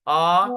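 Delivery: A held long and loud; pulled vowel. Shown as a demonstration. Teacher isolates the vowel of the word, drawn-out demo. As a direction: hold the A of utsahi on a level note. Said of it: "you pull the vowel".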